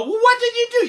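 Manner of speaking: very high, loud exasperated mom; scolding mother. Acted. Mother scolding Jack over wet pants, exasperated. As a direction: screech at your son in mock dismay.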